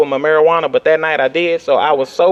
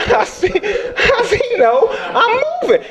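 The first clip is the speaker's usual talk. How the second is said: laughing disbelief; slower than usual. Acted. Repeating his own baffled thought, laughing.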